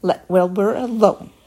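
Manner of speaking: a loud, sharp rebuke; firm loud reprimand; sharp scolding. A narrator's reading. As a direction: snap it at the lamb to defend Wilbur. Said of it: "she spoke sharply to the lamb".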